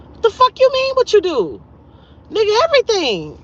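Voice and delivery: high, loud incredulous outburst; outraged. Real, not acted. Scolding a womanizing friend, high loud outrage. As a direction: blow up at the question in disbelief. Outraged retort to his question.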